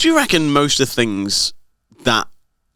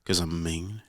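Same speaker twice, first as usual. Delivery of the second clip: cold-faced reply; 5 semitones lower, 11 dB quieter, slower than usual. Retold or imitated. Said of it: "cold-faced look to you".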